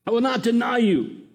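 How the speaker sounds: hammed-up defiant shout; mock shout. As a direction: insist it like Peter shouting. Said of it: "He's shouting".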